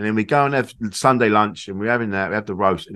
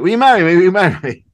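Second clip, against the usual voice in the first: flustered proposal; 7 semitones higher, 7 dB louder, faster than usual. Retold or imitated. Clumsy rushed proposal, loud.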